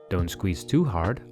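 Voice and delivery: ordinary talk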